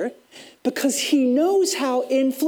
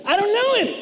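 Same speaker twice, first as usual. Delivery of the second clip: swearing denial; 10 semitones higher, faster than usual. Retold or imitated. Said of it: "cuss and swear when he does it".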